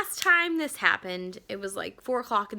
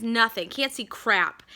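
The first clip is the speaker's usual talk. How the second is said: ordinary delivery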